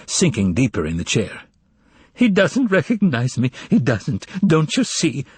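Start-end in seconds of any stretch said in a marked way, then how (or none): 2.1-5.2 sobbing. A narrator's reading. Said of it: "sobbed the secretary".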